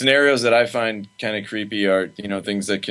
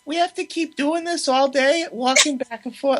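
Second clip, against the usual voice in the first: mocking mom voice. Son mimicking his Italian mom calling his name, high mock voice. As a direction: call his name the way his mom does, much higher. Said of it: "She was like".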